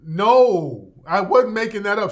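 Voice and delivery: loud and fast, emphatic denial; loud insistence. Real, not acted. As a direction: shout down the doubt, quickly. Insisting after the mockery.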